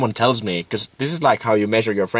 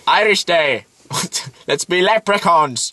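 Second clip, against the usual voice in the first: mock Irish; 6 semitones higher than usual. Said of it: "This sad Irish guy".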